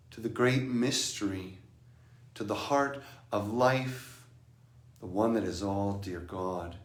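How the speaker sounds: soft, reverent prayer voice; quiet prayer. Acted. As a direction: pray gently and slowly. Offers a prayer.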